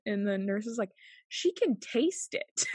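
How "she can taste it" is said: high, slow, drawn-out disbelief; mock incredulous report. Nurse telling older nurse about patient, slow mock disbelief. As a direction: stretch it out high, as baffling news.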